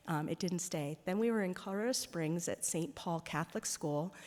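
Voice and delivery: ordinary delivery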